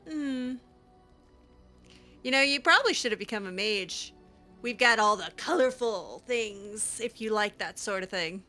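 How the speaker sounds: ordinary talk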